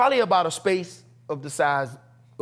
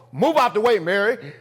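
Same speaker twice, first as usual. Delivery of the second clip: loud, raised fatherly order; higher, forceful retold command; dad's voice; 4 semitones higher, 4 dB louder than usual. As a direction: imitate a dad ordering from indoors. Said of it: "I hear this from on the side of the door".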